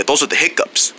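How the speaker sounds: ordinary delivery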